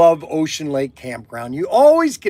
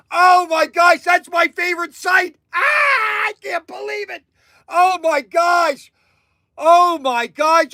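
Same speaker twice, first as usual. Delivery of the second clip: ecstatic; 9 semitones higher than usual. Acted. Ecstatic over favorite campsite.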